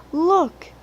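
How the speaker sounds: high, loud, eager call; excited. A narrator's reading. Thomas spots the strange engines, high excited call. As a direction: point it out, bursting with excitement. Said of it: "said Thomas excitedly".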